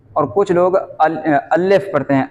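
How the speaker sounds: ordinary talk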